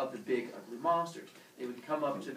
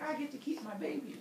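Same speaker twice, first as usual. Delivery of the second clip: high, delighted mother voice; happy Mother Earth; 11 semitones higher than usual. Acted. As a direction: play a pleased mother, pitched up.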